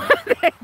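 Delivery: short high giggle; real burst of high giggling; amused laugh. Real, not acted. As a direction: let out a quick laugh. Laughs about eating a ribeye.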